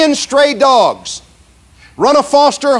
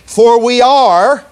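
slow, with "are" stressed and rising; much slower than usual. Shown as a demonstration. Preacher pointing the congregation to present tense, slow stressed "are". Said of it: "present tense".

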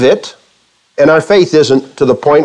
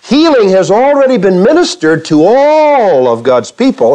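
usual speaking voice

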